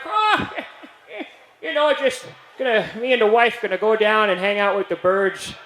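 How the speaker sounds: put-on high, cracking old man voice, chuckling; old man in a nursing home telling the nurse about his wife; doddering old man. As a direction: play a muddled old man, cheerful and lost.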